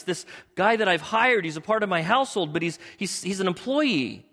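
pleading Abram. Acted. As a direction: beg God to explain himself.

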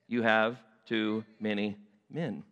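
slow, low, spaced-out words; deliberate verdict. Acted. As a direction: deliver it word by word, unyielding. God repeats his verdict to Gideon.

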